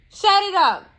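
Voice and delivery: loud angry order; loud command. Real, not acted. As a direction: bark it at him. Commanding the man to stop.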